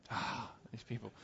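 very soft, breath-heavy mutter; imitated weary disbelief, barely voiced; exasperated sigh. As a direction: sigh it out under your breath. Imitates commercial character's end reaction.

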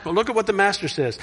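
usual speaking voice

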